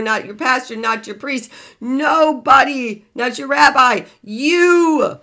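loud, high, emphatic motivational rant; preaching at full force. Real, not acted. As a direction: drive it home, hammering each item on the list. Coach erupts into emphatic rant.

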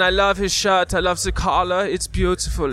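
put-on hammy German accent; German fashion designer. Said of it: "You sound like a German".

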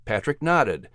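usual speaking voice

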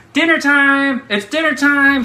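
high, loud call to dinner; mom calling dinner. Retold or imitated. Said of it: "my mom says".

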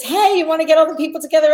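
high, loud, rushed retold excitement; bubbly mimicked call, fast and high; eager invitation. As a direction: imitate a friend gushing on the phone.

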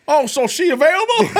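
high, loud teasing take on Tony Atlas; squeaky mocking question. Teasing co-host mimics the wrestler, high and loud.

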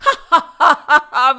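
loud gloating villain laugh. Acted. Vishwarath gloating over the drowning people, loud villain laugh. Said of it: "Vishwarath said".

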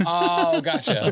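laughing. Real, not acted. Laughing realization.

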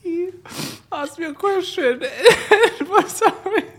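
very high, squeaking, words broken up by laughing; real helpless laughter. Real, not acted. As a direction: gasp the story out between fits of laughter. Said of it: "I've never seen you laugh this hard".

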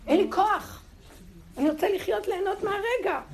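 high, loud pleading cry; desperate loud plea; anguished outcry. Acted. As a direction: cry out that you want to live. Exclaiming desperate cry to live.

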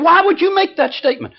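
offended lady demands a reason, outraged; angry lady. Retold or imitated. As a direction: play her shrieking indignation. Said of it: "Boy, she was getting angry".

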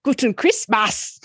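slow, lightly put-on German accent; mock German accent. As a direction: toss off a jokey German greeting. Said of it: "German is where the".

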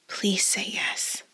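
slow, breathy whisper; whispered plea. A narrator's reading. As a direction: beg her softly. Doric pleads.

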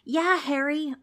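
high-pitched hearty agreement; hollering. A narrator's reading. Demon backs up Harry, hollering. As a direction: holler over to a companion. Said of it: "hollered another demon".

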